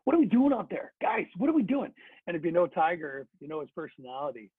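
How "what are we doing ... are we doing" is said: higher, exasperated retold bench rant; mock upset. Veteran on the bench, upset at his losing team, exasperated. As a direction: play the frustrated leader pleading with his team. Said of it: "He's really upset on the bench".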